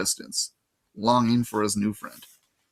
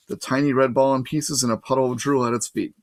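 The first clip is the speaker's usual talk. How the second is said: ordinary delivery, nothing marked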